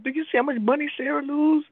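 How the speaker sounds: high, nosy gossip about Sarah's money; gossiping friends. Retold or imitated. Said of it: "They like".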